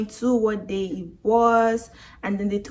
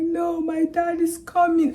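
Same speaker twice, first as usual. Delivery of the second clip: high, slow tearful protest; mock crying; 9 semitones higher than usual. Crying victim refusing the hospital trip, mock-tearful. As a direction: imitate yourself wailing a refusal. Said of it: "I was bawling my eyes".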